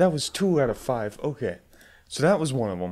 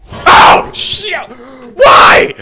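high, loud scream of dismay; shriek, then wail in protest; startled wail; 14 semitones higher, 18 dB louder, much slower than usual. Real, not acted. Player scared yet again, startled wail.

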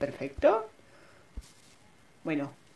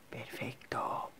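slow breathy whisper; 15 dB quieter, much slower than usual. Real, not acted. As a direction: whisper it slowly. Sneaking quietly.

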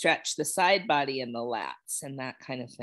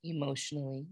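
calm, unhurried guiding voice with long pauses; guided meditation; 4 dB quieter than usual. Real, not acted. Yoga teacher guiding students to notice how they feel, meditative. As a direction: ease them gently into noticing body and breath. Leads a settling body check-in.

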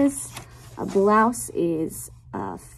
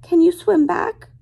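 higher, louder pig calling out sorry; pleading pig voice; 7 semitones higher, 6 dB louder than usual. Acted. Pig in the story calling her friends to swim back, higher pleading call.

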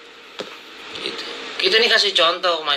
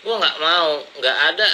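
ordinary talk, nothing marked

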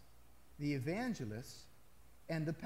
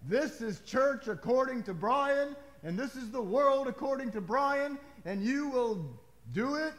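pompous self-important decree, higher and louder; mock arrogant pastor; 8 semitones higher, 7 dB louder than usual. Pastor mocking a preacher who makes church his own, pompous proclamation. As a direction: lord it over the flock in a puffed-up voice. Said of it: "stand up here in front of Jesus and say".

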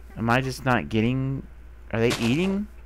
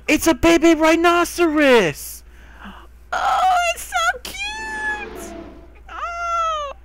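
high, loud squeal that stretches slowly; excited squeal; 5 dB louder, much slower than usual. Real, not acted. Spotting a baby rhino, squealing high and loud with delight.

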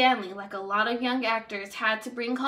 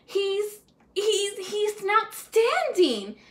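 higher, slow stammering awe; drawn-out wonder at the find; amazed declaration; 9 semitones higher, much slower than usual. A narrator's reading. Producer Hoggers amazed by the singing cabbie, higher and slow. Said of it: "declared Hoggers to Hammerswine".

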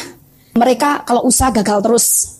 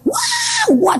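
high mock wail of someone manifesting; imitated scream. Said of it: "anaknya yang teriak". (tiruan jeritan melengking)

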